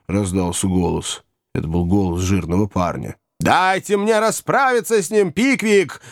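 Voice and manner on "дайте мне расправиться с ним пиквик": high-pitched angry outcry; furious shouting. A narrator's reading. Old man lunging at the boy, furious shouting. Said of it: "закричал мистер Уордль".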